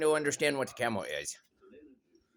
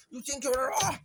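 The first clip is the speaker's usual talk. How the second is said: high squealing playful cry; 9 semitones higher than usual. Real, not acted. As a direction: squeal with delight. Squealing in play.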